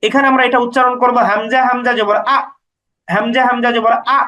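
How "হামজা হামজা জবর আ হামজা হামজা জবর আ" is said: final আ cut short by a glottal stop; abrupt hamza stop. Shown as a demonstration. Teacher showing hamza with sukun, আ stopped short. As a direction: spell হামজা হামজা জবর, then halt sharply on আ. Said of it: "উচ্চারণটা একটু থেমে যাওয়ার মতো হয়".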